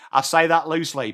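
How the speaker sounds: ordinary delivery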